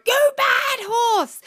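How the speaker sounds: high, loud, drawn-out telling-off of the horse; mock scolding shout. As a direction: yell at the horse in pretend anger. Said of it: "I'm not whipping her or going".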